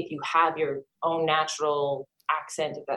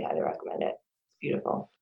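quick hushed whisper, softer still; fast whisper; 12 semitones lower, 9 dB quieter than usual. Real, not acted. Guest repeating her Prague tip in a hushed whisper. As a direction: murmur it fast like a secret aside.

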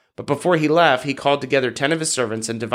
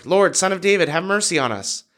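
clearly higher, lightly projected plea; mild shout, more insistent; 6 semitones higher than usual. A narrator's reading. Blind men persisting over the crowd, mild insistent shout. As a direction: lift the pitch into an understated narrated shout. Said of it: "they only shouted louder".

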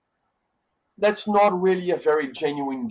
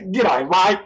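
loud, higher broad Australian mate talk; Aussie reply; 9 semitones higher, 5 dB louder than usual. Retold or imitated. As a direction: answer like a friendly Aussie bloke. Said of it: "You gotta say back to the guy".

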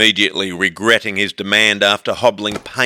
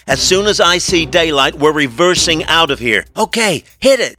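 lightly voiced woman, pitched up; urgent Lena; 7 semitones higher than usual. A narrator's reading. Lena tells Amy the escape plan, urgent.

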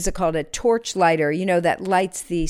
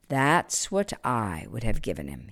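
murmured; 7 semitones lower, 6 dB quieter than usual. Acted. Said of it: "he murmured".

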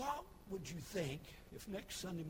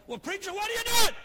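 much higher, louder alarmed cry; alarmed shout; 20 dB louder than usual. Acted. Churchgoer yelling at the preacher wrecking the pulpit. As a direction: yell it in disbelief.

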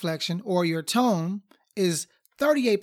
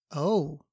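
a subdued, falling "oh"; different-meaning oh; 3 semitones lower than usual. Shown as a demonstration. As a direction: let "oh" drop, unimpressed. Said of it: "conveyed it a different meaning".

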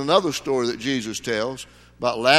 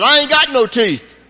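high, loud indignant cry; woman crying out; 10 semitones higher, 7 dB louder than usual. Retold or imitated. Heckling woman calls out to the preacher, high and loud. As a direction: blurt it out from the crowd. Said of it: "this woman cried out".

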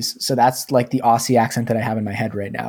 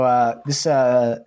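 ordinary delivery

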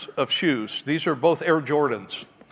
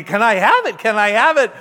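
put-on a bit louder, eager kid pleading; eager begging; 5 semitones higher, 6 dB louder than usual. Son begging his dad for the autographed ball, eager. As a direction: beg like a kid bouncing on the spot.

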